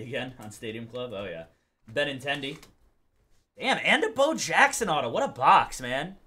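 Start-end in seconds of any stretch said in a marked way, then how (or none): none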